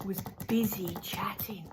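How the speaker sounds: whisper. Shown as a demonstration. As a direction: breathe the words without voice. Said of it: "repeated as a whispered echo".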